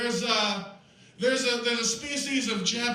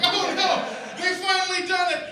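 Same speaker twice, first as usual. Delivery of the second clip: mock panic; 10 semitones higher, 3 dB louder than usual.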